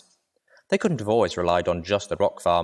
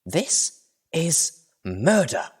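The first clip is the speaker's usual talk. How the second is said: higher, slow, stretched-out outrage; indignant accusation; 8 semitones higher, much slower than usual. Acted. Velvet Remedy confronts Little Pip, slow outraged accusation. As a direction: drag it out like an accusation. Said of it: "a stare that could petrify".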